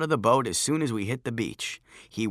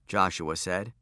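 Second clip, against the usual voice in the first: ordinary delivery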